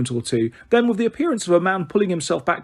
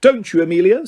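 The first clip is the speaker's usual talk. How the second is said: smirking; 5 semitones higher, 4 dB louder than usual. A narrator's reading. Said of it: "still smirking".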